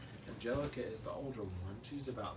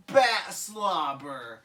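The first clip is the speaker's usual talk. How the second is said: slow, louder drawn-out; gross-out baby; 4 semitones higher, 11 dB louder, much slower than usual. Acted. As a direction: savour the gross words slowly. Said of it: "Lil's like".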